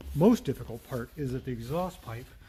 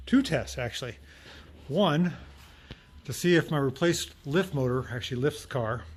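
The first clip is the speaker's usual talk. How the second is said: usual speaking voice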